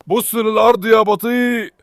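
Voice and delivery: slow, high shouted call; shout at dog. Real, not acted. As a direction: yell it out slowly.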